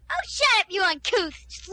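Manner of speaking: high screeching shout; shrieking insult. Acted. The hostess screeches at the guest during the fight.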